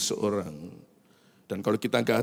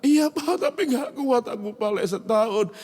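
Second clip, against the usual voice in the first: much higher, loud whiny plea; whining child; 12 semitones higher, 3 dB louder than usual. Retold or imitated. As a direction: squeal it out like a begging kid. Son begs father.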